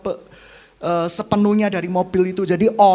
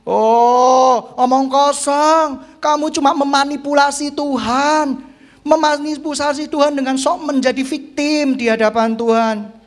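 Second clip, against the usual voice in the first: ringing sermon warning, pitched up; forceful preaching rebuke; 5 semitones higher, 4 dB louder than usual. Real, not acted. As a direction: rebuke the congregation from the pulpit.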